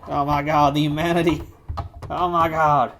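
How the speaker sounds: loud Southern commentator's cry; hammed-up Southern play-by-play; Jim Ross. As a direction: call it like an aghast Southern announcer. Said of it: "It's Jim Ross narrating his trials".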